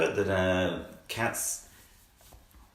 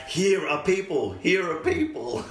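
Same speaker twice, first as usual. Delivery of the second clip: put-on high, slow baby wail; baby calling out; 10 semitones higher, slower than usual. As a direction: squeal it like a baby calling lions. Baby voiced calling to lions.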